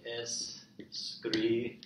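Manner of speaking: very slow, broken into es-cri-ba; syllable by syllable. Shown as a demonstration. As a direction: sound out "escriba" in drawn-out syllables. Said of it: "Step three".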